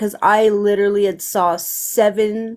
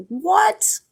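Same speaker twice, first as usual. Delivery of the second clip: high rising what of disbelief; a sudden high what?, like you can't believe it; stunned what; 10 semitones higher than usual. Retold or imitated. Stunned by the girl's reading.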